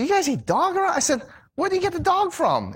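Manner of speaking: high, rising incredulous cry; appalled tourist, high and shocked; shocked disbelief. Retold or imitated. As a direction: squeal it out as if you can't believe it. Shocked at eating dog.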